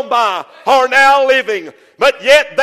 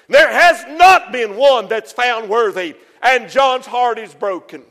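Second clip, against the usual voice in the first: ordinary talk, nothing marked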